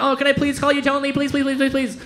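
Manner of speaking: high, rapid eager begging; mock pleading. Waspy acquaintances begging for a nickname, mock eager pleading. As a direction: plead over and over like an excited fan.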